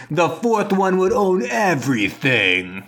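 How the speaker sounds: high, slow, hushed greed; mock villain awe. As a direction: savour the idea like a cartoon villain. Acted villain realization.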